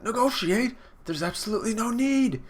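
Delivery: high-pitched woman character; high mock-outraged leading lady; indignant wife. Wife voiced rebuffing husband's idea.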